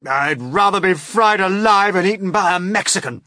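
a hint of an old insect wailing in dread. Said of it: "wailed the old green grasshopper".

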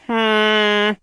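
long, loud, high hum; saw hum. A narrator's reading. Narrator imitates the saw at work, loud high humming. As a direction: imitate a whirring saw blade.

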